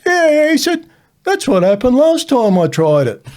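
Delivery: put-on high, loud, offhand kid voice; imitating a nonchalant kid. Blackened kid tells teacher it happened last time too, high and offhand. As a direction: toss it off like it happens every time. Kid casually shrugging off injury.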